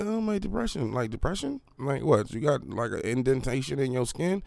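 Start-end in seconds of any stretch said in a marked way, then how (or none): 0.1-0.9 high, soft mock sigh; lightly voiced whiny complainer; mocking whiny woman. Mocking women who complain, high soft whine. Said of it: "especially women, be like".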